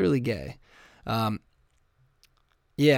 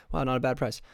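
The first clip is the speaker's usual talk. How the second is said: ordinary talk, nothing marked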